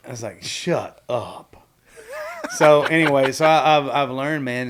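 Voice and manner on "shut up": drawn-out "Shut ... up"; stunned, spaced-out "no way"; disbelief. Shown as a demonstration. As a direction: stretch both words in amazement. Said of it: "that means in disbelief".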